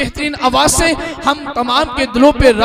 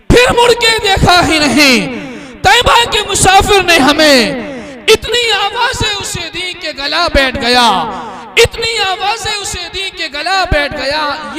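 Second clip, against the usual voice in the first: raised, ringing poetry recitation to a crowd; recited verse; 4 semitones higher, 4 dB louder than usual. Acted. Announcer reciting the sher to the crowd, raised and ringing. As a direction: declaim the couplet for the gathering.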